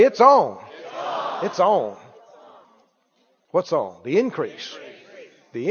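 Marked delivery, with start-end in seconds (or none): 0.0-0.6 call and response. Shown as a demonstration. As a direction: get them to say it back.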